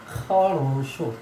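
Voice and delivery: खरोशो drawn out very slowly, "khaa-roo-shoo"; very slow pronunciation demo. Shown as a demonstration. Teacher slows the word down for students, drawn-out demo. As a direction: stretch every syllable of खरोशो. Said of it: "pronunciation इसका थोड़ा अलग है".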